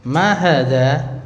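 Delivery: "Ma hadza" stretched slowly with a rising end; question intonation. Shown as a demonstration. Teacher models the Arabic question for the class, questioning intonation. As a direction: ask "Ma hadza" as a slow, lifted question. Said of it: "Kalau pakai intonasi gitu ya".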